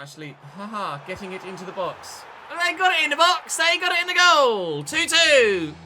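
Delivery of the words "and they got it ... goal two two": much higher, much louder yell; real goal yell for the equaliser; goal celebration. Real, not acted. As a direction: roar the equaliser. Team scores equaliser.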